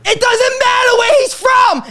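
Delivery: shrieky high, slow, stretched-out yell; exasperated shout. Retold or imitated. Son answering mom's endless questions, exasperated shout. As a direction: blow up over a pointless question.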